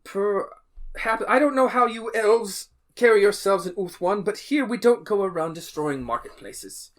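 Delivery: a judge scolding the court, loud and high; stern judge. Acted. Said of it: "the judge is like".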